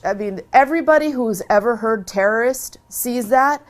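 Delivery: ordinary talk, nothing marked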